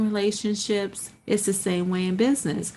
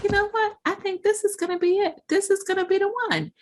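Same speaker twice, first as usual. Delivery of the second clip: high, quick, eager rush; acted excitement; 11 semitones higher, much faster than usual. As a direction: race through it on a fresh wave of hope. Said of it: "you get excited about it".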